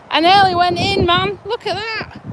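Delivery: high shocked gasps and laughter; nearly fell in, shaken and laughing. Real, not acted. Nearly slipped into river.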